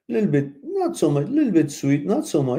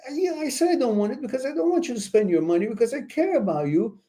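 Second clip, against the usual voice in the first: higher, fast, lightly playing the woman; lightly voiced caring woman; 11 semitones higher, faster than usual. Host voices a girlfriend explaining to her man, higher. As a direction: explain yourself like a caring girlfriend, quick and high. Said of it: "she's gonna say".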